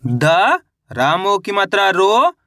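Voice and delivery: द alone, then रो drawn out with a rise; letter-by-letter spelling. Shown as a demonstration. Teacher shows pupils how to sound out the word, letter by letter with रो drawn out. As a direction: spell slowly, pausing after द, stretching रो upward. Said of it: "अब उच्चारण किस तरीके से करेंगे".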